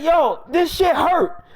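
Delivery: high, drawn-out mock wail; retold high-pitched yell; screaming in pain. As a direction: complain loudly of pain, stretched out. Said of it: "he starts screaming and stuff".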